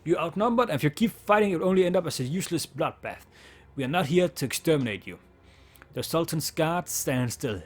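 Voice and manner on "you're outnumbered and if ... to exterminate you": commanding surrender call. Acted. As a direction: order them to give up, raised and firm. Demanding surrender from the guards.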